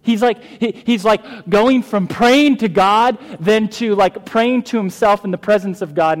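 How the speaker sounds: loud, high, emphatic explaining; forceful preaching. Acted. Pastor explaining the psalmist's prayer to the congregation, forceful preaching. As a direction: drive the point home to the room.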